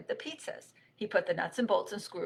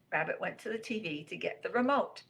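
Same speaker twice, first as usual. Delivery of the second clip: ordinary delivery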